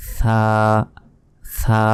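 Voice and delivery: সা as a lisped "thaa", tongue between the teeth; drawn-out Arabic tha in সা. Shown as a demonstration. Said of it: "জিহবাটা আপনারা সামনের দিকে".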